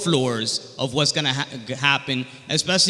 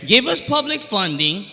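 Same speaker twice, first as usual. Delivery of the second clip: rally the room, voice raised high; fiery speech; 6 semitones higher than usual. Real, not acted. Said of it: "You set the room on fire".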